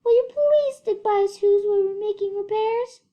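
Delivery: very high, loud pleading little voice; pleading mayor. A narrator's reading. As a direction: beg like a tiny worried mayor. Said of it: "pleaded the voice of the mayor".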